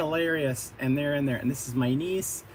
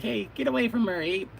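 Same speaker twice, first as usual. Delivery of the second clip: higher, heroic call to the ape; a lightly voiced hero, pitched up; 8 semitones higher than usual. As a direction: warn the ape off like a young hero. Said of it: "one of the heroes is like".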